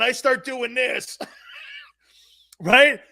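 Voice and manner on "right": usual speaking voice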